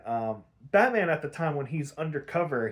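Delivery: ordinary delivery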